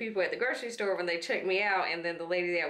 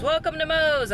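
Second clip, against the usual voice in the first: high, loud shouted welcome; a playful imitation of the restaurant's welcome call; mock Moe's greeting; 10 semitones higher, 4 dB louder than usual. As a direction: belt it out like the whole staff greeting you.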